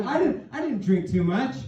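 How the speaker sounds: high, squeaky denial; hammed-up guilty husband; defensive protest. Husband acts out his protest.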